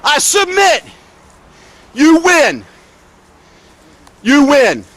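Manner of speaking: high, sarcastic "I submit"; mock submission to the sergeant; bitter surrender. Real, not acted. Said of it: "apologize for getting emotional".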